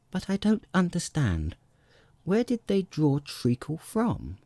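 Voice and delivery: cautious. A narrator's reading. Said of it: "so she began very cautiously".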